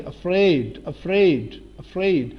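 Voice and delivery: افریڈ with a clear r after f; sounded r. Shown as a demonstration. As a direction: drill افریڈ as "a-fraid", falling each time. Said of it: "ر sound پڑھیں گے".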